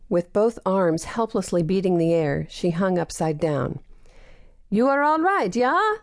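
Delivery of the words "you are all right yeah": higher, called up the tree; calling out. Acted. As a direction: call up to her hanging above. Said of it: "Olsen called".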